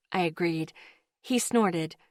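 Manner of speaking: ordinary delivery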